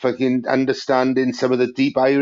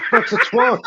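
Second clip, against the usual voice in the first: very high, disbelieving What's a twat; mock incredulous. To friends, a high disbelieving question. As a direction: squeal it in disbelief. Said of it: "they say twat".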